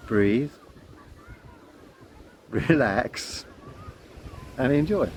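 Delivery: slow mantra with long pauses between words; calm mantra. Shown as a demonstration. Repeating the children's mantra, slow and calm. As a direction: let each word settle, unhurried. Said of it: "the mantra I give to the children".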